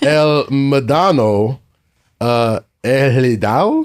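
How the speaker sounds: Spanish accent, carefully slow. Real, not acted. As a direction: repeat the names slowly in a Spanish accent. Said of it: "That's your Spanish accent".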